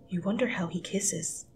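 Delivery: unvoiced dreamy whisper; soft romantic whisper. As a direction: drift into a hushed daydream.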